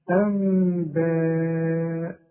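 أنباء with long hummed meem, very slow; iqlab ghunna. Shown as a demonstration. Teacher recites example for class, slow ghunna. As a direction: stretch the nasal hum in أنباء. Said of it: "أهي".

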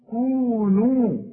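"كونوا" with the long vowel held evenly, slow and loud; even vowel length. Shown as a demonstration. Teacher showing an evenly measured long vowel in "كونوا". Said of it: "لا تزود دي ولا تنقص دي".

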